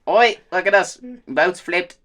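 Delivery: loud, high-pitched mock Aussie accent; Australian accent. Stranded boater films himself on his GoPro, loud mock Australian accent. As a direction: call out like an Australian vlogger. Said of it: "how different Australians are".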